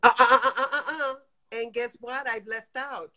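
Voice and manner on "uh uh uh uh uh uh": loud, choppy burst of laughter; staccato laugh. Real, not acted. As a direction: laugh out in quick separate bursts, then ease off. Caller laughing before her next point.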